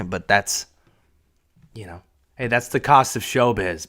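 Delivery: usual speaking voice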